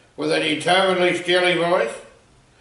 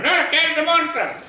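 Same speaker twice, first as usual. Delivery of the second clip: panicked insistence; 11 semitones higher than usual. Retold or imitated.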